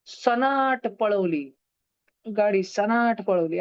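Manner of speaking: slow mnemonic line with a drawn-out सनाट; slow recital. Shown as a demonstration. Teacher finishing the memory trick, slowly. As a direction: stretch the second vowel of सनाट. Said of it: "धीरे धीरे बोल देता".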